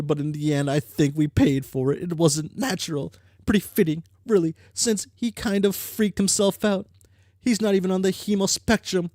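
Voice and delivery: put-on troll girl character voice. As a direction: voice the troll with cocky energy. Reads game character lines.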